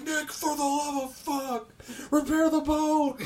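high, loud, drawn-out plea; exasperated yell. Retold or imitated. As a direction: holler at a useless teammate, dragging it out. Retold exasperated yell at teammate.

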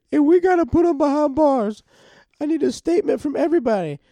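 high, loud ranting accusation; hammed-up outrage. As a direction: imitate a distraught girlfriend yelling for justice. Acting furious girlfriend accusing, demanding justice.

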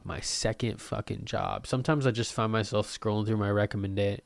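usual speaking voice

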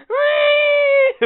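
very high, loud squeal; long, piercing mock scream; imitated moped scream. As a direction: squeal like an overloaded engine in agony. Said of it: "that thing is screaming".

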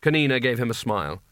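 plain reading voice

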